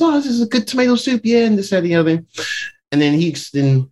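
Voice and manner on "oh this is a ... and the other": mock chirpy Steven.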